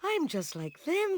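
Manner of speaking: high little bat voice; small bat voice. Acted. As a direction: think it hopefully, small. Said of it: "thought Stellaluna".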